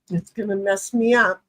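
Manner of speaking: usual speaking voice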